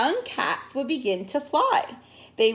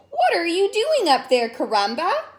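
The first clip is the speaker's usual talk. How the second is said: surprised; 6 semitones higher, 4 dB louder than usual. Acted. Portia calls up.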